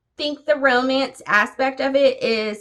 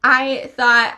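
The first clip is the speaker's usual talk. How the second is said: normal talking voice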